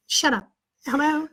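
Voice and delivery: high put-on mother; fake mom voice. Teen plays his mother answering the teacher, high-pitched voice. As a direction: impersonate Mom taking the phone. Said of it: "I got the voice down pat".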